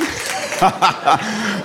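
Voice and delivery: real laugh breaking out; laughter. Real, not acted. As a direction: burst out laughing at the audience. Laughs on stage. (echtes Lachen)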